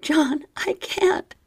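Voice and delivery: high, slow whispered whimper. A narrator's reading. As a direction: whimper it out slowly. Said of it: "I whimpered".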